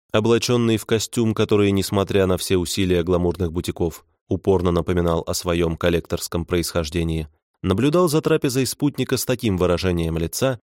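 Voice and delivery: ordinary delivery, nothing marked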